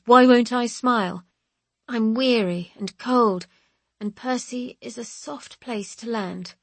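plain reading voice

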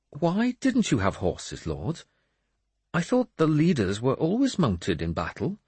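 high-pitched questioning voice; puzzled priest. Acted. Priest asks his lord about horses, puzzled. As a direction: query it high, at an unhurried pace.

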